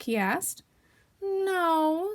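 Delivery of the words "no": high, airy denial; feigned nonchalance, raised pitch; careless. Acted. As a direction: brush it off as if nothing's wrong. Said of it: "said Pooh carelessly".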